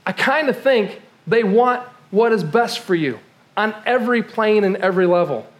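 ordinary talk, nothing marked

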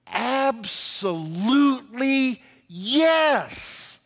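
"Absolutely yes" very slow and high, word by word; emphatic pronouncement. Real, not acted. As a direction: stretch out "Absolutely yes" as a big verdict. Dramatic answer to posed question.

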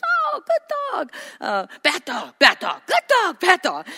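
high, cooing praise then loud scolding; cooing to a dog. Shown as a demonstration. Owner showing a dog good and bad by pitch alone. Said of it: "we're cooing and the pitch of our voice".

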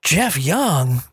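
imitating a fan who can't believe it's him; excited recognition. Peggy meeting her favourite food blogger, thrilled and surprised. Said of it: "Peggy Normandin looks at me and goes".

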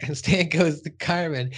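ordinary delivery

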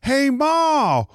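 very high-pitched hammed-up yokel; squeaky comic country-bumpkin voice. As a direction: holler to Ma like a cartoon hillbilly. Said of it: "scene going on of some dude in overalls and no shirt".

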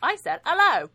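high, loud, squeaky Labyrinth-worm hello; high, loud mock worm voice; little worm voice. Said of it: "And he's like".

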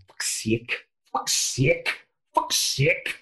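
unvoiced, building angry rehearsal of the curse; whispered rehearsal that builds, each try sharper; practising an angry swear. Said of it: "he's going ... like this".

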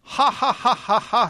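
high, separate ha-ha-ha syllables; mock a forced movie laugh; imitated fake laugh. Imitating the laughing scene at the end, fake guffaw.

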